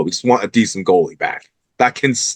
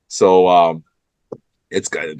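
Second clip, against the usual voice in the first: usual speaking voice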